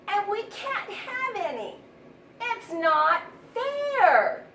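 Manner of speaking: high, loud, slow complaining mouse; whiny mouse. A narrator's reading. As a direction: drag out it's not fair like a sulky mouse. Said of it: "said the little mouse's friend".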